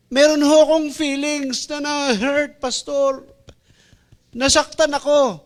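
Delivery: high, slow, plaintive voice of the hurt one; hurt church member. Acted. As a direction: whine it like a wounded member. Sermon role-play of hurt member. (mataas at nagmamaktol na boses ng nasaktang miyembro)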